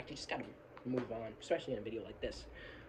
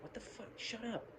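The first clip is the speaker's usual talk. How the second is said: high, soft mock outburst; imitated exasperated viewer; 10 semitones higher, 8 dB quieter than usual. Imagined viewer reacts to fast talk, mock exasperated. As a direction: play an annoyed viewer in a small high voice. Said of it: "you're just like".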